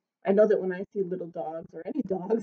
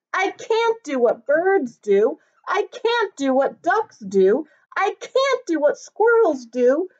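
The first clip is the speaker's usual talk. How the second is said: higher small voice building to a louder, slower cry; little dog Norbert, lamenting; 7 semitones higher, 6 dB louder, slower than usual. Acted. Tiny dog listing all he can't do, building to a higher, louder plea. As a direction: pile up the frustration till it breaks out.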